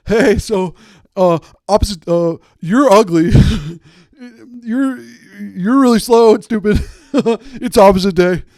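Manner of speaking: put-on high, loud squeaky child taunting; a second grader yelling insults on opposite day; playground kid voice. Playground kid shouting taunts at a classmate.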